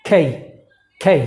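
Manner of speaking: খেই with a puffed k and gliding vowel; correct pronunciation. Shown as a demonstration. Teacher modelling the correct letter name, aspirated k. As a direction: breathe out on k, glide through খেই. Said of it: "আমার সাথে বলুন".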